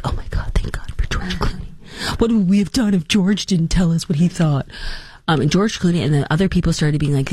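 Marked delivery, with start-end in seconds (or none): 0.0-4.7 sarcastic breathless whisper of relief; mock gushing. Mocking fans' hushed awe over Clooney.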